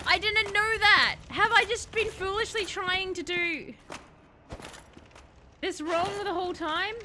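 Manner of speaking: high, loud and slow stunned protest; dismayed realization. Real, not acted. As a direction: drag it out in dismayed shock. Realizes she did it wrong.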